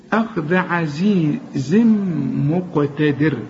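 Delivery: أخذ with the dhal between the teeth, slow; slow careful أخذ عزيز; tongue-out dhal. Shown as a demonstration. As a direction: recite أخذ عزيز مقتدر slowly and precisely. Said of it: "طلع لسانك في الذال".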